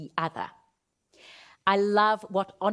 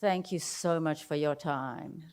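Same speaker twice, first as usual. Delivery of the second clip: lower, softer and slow; lower, half-hearted thanks; flat low thanks; 6 semitones lower, 6 dB quieter than usual. Shown as a demonstration. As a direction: thank them with no energy. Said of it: "the difference between".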